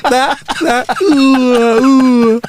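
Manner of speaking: high squeaky muffled doctor. Acted. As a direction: play it in a tiny high voice. Replaying the doctor's voice.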